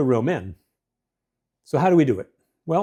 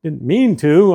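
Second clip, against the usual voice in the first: louder, tossed-off sorry on the way out; careless brush-off; 3 semitones higher, 8 dB louder, slower than usual. Retold or imitated. Husband brushing off his wife while leaving, loud careless sorry. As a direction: fling a careless apology over your shoulder. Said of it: "You know".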